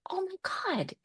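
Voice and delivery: breathless high whisper of disbelief; awed whisper. Retold or imitated. Stunned daughter whispering at dad's vision. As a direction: gasp it out, barely voiced, stunned. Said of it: "It was just like".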